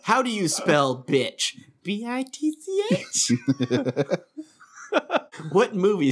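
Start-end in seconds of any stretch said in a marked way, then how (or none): 1.8-3.3 spelled out with a high questioning lilt; uptalk. Shown as a demonstration. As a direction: end every letter on an upward question. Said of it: "said it with a, the answer with an upward inflection".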